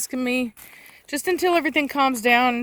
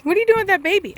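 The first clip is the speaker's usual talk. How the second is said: calling the dog; 10 semitones higher, 4 dB louder, much faster than usual. Real, not acted.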